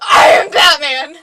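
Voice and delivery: loud, hammed-up superhero growl; Batman. Coaching co-host to find an inner vigilante, mock roar. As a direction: declare it like a brooding vigilante, shouting. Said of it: "find your inner Batman and bring that out".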